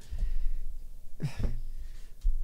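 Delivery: long unvoiced sigh of frustration; exasperated sigh. Real, not acted. Streamer fed up with reading blog notes, long exasperated sigh. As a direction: let out a long weary sigh.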